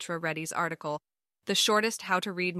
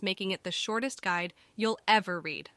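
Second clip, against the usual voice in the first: normal talking voice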